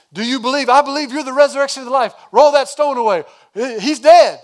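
rapid-fire jumble of voices, high and loud; acted confusion. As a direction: act out bewildering exchanges, building to a loud protest. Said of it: "I'm confused".

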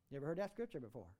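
normal talking voice

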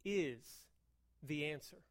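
soft, low, sincere delivery; earnest quiet preaching. Real, not acted. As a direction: drop down and mean it.

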